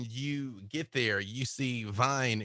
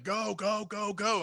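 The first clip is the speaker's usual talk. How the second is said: Jake urging; 10 semitones higher than usual. Acted. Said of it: "he's like".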